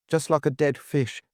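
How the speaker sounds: ordinary delivery, nothing marked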